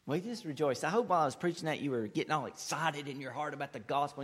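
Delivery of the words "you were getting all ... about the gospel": normal talking voice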